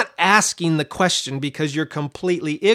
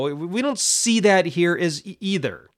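ordinary talk, nothing marked

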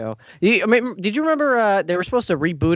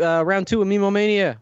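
usual speaking voice

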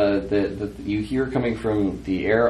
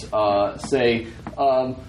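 ordinary talk

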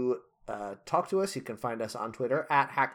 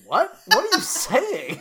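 incredulous; 7 dB louder than usual. Real, not acted. Disgust at ham pairing.

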